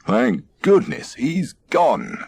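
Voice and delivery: sighed. A narrator's reading. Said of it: "sighed those very same landlubbers".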